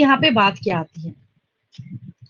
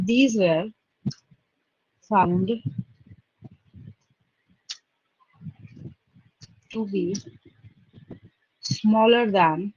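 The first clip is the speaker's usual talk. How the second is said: slow dictation; 5 semitones lower, 6 dB quieter, much slower than usual. Real, not acted. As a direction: lower voice, words spaced far apart. Dictating while writing out the statement. (रुक-रुक कर, शब्द-दर-शब्द लिखवाते हुए)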